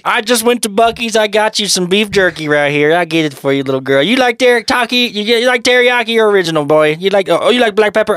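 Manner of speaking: a jolly Texan offering jerky; Texan accent. Pretend. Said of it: "your Texan accents".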